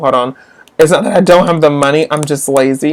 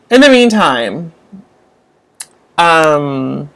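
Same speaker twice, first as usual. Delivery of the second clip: ordinary delivery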